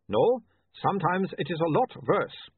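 put-on slow, matter-of-fact Uberwaldian accent; lightly voiced Uberwaldian accent, unbothered. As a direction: shrug it off in Uberwaldian. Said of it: "don't make fun of his accent".

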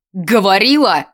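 loud, angry bark; gruffly bellowed accusation; barked demand. A narrator's reading. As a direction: snap it out like an interrogator. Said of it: "рявкнул Шеремет".

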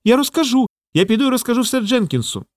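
high-pitched defiant outburst; squirrel crying out. A narrator's reading. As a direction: exclaim it high, as a threat to tell. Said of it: "і викрикнула".

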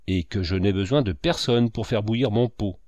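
plain reading voice

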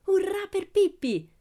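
very high, loud, drawn-out hurrah; cheering children. A narrator's reading. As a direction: cheer like delighted kids. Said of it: "gridarono Tommy e Annika". (urrà festoso di bambini)

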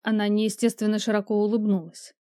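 ordinary delivery, nothing marked

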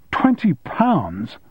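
slow, high disbelieving splutter. A narrator's reading. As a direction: stretch it out in shocked disbelief. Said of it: "he spluttered".

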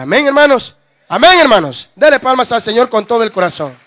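fuerte, clamando a la congregación; llama a la iglesia a alabar; predicación a toda voz. Acted. (predicación fervorosa en voz alta)

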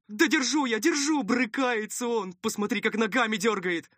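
high, urgent protesting voice; high-pitched flustered helper; harried underling. Acted. Helper protests while holding patient.

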